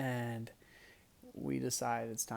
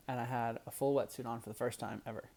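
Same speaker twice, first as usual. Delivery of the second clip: ordinary talk, nothing marked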